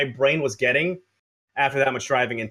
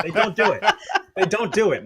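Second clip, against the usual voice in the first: laughing repeat; 5 semitones higher than usual. Acted. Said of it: "Friends don't let friends drive".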